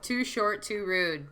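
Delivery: ordinary talk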